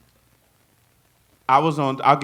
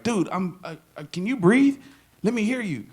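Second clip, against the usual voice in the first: alarmed doctor; 8 semitones higher, 5 dB louder than usual. Retold or imitated. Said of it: "And he's like".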